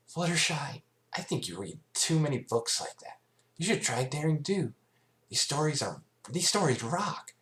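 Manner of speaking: high, cocky tomboy voice; brash Rainbow Dash. Acted. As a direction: brag about the stories. Rainbow Dash teasing friend.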